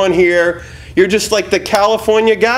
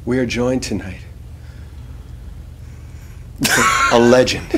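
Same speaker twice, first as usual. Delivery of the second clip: much lower, softer, heavy with feeling; deep emotional delivery; 8 semitones lower, 7 dB quieter than usual. Acted. Host redoing the intro on request, deep and emotional. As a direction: reach down deep and mean it. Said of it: "I want you to reach down deep".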